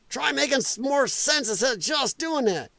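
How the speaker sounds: ordinary talk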